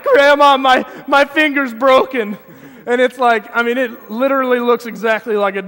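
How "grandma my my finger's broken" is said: put-on much higher and louder, dramatic kid's whine; wailing child. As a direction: cry out like a little kid who thinks it's broken. Child wailing about hurt finger.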